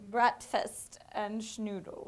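put-on slow German accent. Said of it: "a German accent?".

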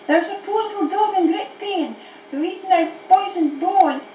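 high-pitched, anxious appeal; begging. Acted. Dog begs mistress to help poisoned friend, pleading. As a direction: plead high for someone in trouble. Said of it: "I begged her".

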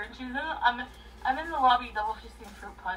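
ordinary talk, nothing marked

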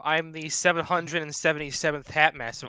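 ordinary talk

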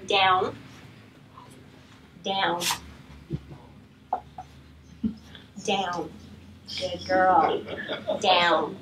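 low, calm, firm down; steady command without harshness; calm assertive command. Shown as a demonstration. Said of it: "it's calm and it's assertive".

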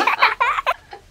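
high, loud burst of laughter. Real, not acted. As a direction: laugh out loud at the silly sound. Reacting to megaphone singing.

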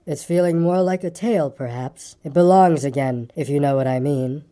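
low, gloomy donkey voice; gloomy, long-suffering and low; a low, woeful grumble; melancholy grumbler. Acted.

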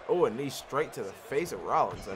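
usual speaking voice

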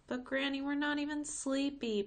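put-on high whiny child's protest; a girl protesting nap time. A narrator's reading. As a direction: complain like a six-year-old. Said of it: "protested Tamika".

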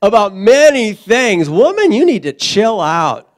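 slow, loud, exasperated rebuke; Jesus chiding. Retold or imitated. Jesus rebuking anxious Martha, loud and slow. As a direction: scold like a fed-up teacher. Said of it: "the Lord replied".

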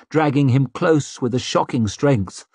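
ordinary delivery, nothing marked

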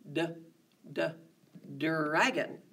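the D sound stretched and stressed in dragon. Shown as a demonstration. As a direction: hit the D in dragon, d, dragon. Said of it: "Can you hear it?".

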